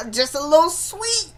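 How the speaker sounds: sweeten it way up, teasingly sugary. Shown as a demonstration. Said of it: "How he said it, y'all, was a little sweet".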